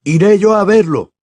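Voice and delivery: put-on young man offering at once; eager offer. A narrator's reading. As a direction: jump in keen to help. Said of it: "se ofreció Bill".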